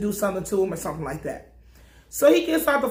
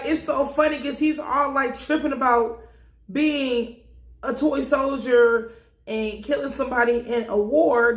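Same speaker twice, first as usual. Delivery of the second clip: normal talking voice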